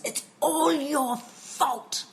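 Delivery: drawn-out, acted angry accusation; imitating son losing it. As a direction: hurl the blame like a fuming child. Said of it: "he lost it".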